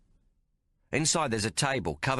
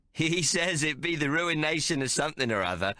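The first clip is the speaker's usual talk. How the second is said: country clod; 6 semitones higher than usual. A narrator's reading. Tomo fools the card players, as a bumpkin. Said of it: "like Timmy Dankmarsh".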